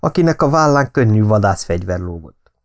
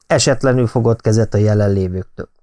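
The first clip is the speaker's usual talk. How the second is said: usual speaking voice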